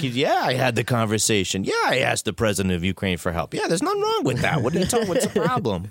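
high, defensive shrugging denial; mock politician. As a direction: play it innocent and dismissive. Impersonating evasive politician.

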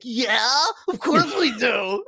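high, loud, slow mock-giddy yes; eager acceptance. Brothers invited to the analytics after party, giddily eager.

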